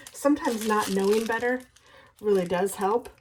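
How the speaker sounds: loud, pumped-up declaration; excited loud. Real, not acted. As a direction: hype yourself up loudly. Going for it, pumped.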